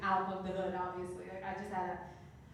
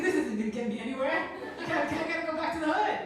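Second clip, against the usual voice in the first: laughing despair; 6 semitones higher, 6 dB louder than usual. Retold or imitated. Quote after losing contest.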